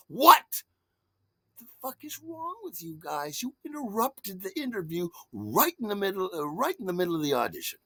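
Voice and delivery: scolding his sister for interrupting the audition, high and exasperated; exasperated outburst. Retold or imitated. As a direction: blow up at them, then keep ranting. Yelling at sister and brother-in-law.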